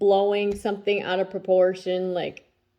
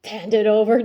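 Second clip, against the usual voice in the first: louder call; 2 semitones higher, 9 dB louder than usual. Real, not acted. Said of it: "and I'm just like".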